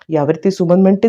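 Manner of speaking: ordinary delivery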